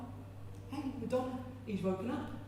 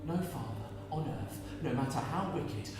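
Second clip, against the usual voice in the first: usual speaking voice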